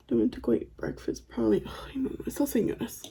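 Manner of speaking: hushed gossip under the breath; confiding, barely voiced aside; secretive whisper. Real, not acted. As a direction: whisper it so relatives can't overhear. Gossiping about relatives within earshot.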